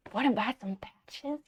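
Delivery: a hint of a sleazy hustler; lightly voiced shady pitch; shady seller again. Streamer riffs back on the parking lot seller, lightly voiced sleazy pitch. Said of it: "callback to the watch seller".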